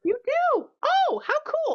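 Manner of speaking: delighted surprise. Real, not acted. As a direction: gush with real surprise. Surprised at adjustable bed news.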